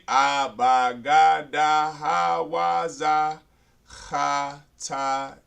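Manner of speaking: slow, evenly stretched syllables; drawn-out recitation. Shown as a demonstration. Teacher reviewing the letters we did, slow drawn-out recitation. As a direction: recite each letter long and level.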